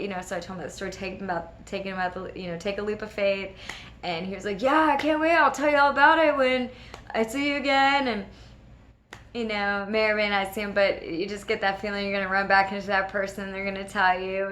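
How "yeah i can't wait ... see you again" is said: high, excited retold reply; eager anticipation. Stranger promising to tell her all about it, eager anticipation. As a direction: act out someone who can't wait.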